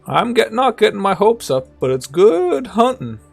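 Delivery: put-on high old man voice; a hint of an old scavenger.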